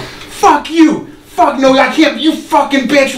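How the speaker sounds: high-pitched angry impression of a drunk friend; berating rant. Storyteller acts out his friend cursing someone outside, berating. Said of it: "berating a homeless woman outside".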